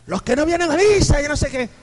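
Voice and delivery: high, loud mock scolding of absent churchgoers. Priest mockingly scolding those who skip mass, high and loud. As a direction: rant like a priest scolding from the pulpit.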